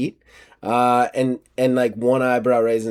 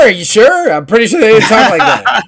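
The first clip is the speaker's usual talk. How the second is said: much higher, louder incredulous questioning; mock disbelief; 10 semitones higher, 10 dB louder than usual. Sceptic answering a supposed Spaniard who sounds wrong, loud mock disbelief. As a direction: play a loud, high-pitched doubter.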